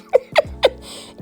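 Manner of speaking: a high giggle; high squealing laugh. Real, not acted. Laughs after her answer.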